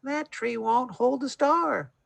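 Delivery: put-on high, slow child cry; little bear voice. A narrator's reading. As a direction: call out like a little cub. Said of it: "cried out".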